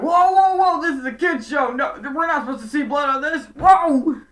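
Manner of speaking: high, loud, frantic cries of alarm; panicked freakout. Acted. The Viveks panicking as Shelby slashes at them, high and loud. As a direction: yelp like startled cartoon minions.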